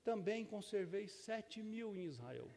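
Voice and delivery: gentle reading. Shown as a demonstration. Older pastor rereads God's words to Elijah, gentle and soft. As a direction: say it gently, like comfort. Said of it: "a gente lê o texto hoje assim".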